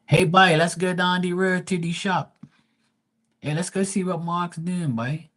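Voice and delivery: high, thick Bermudian street-talk impression; Bermudian accent. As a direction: imitate local Bermudians calling out a greeting, high-pitched. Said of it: "That's how they talk".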